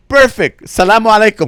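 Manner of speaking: loud, high dismissive farewell; retold send-off at full volume; emphatic sign-off. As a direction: belt out a goodbye to end the argument. Acted abrupt, loud goodbye in quarrel.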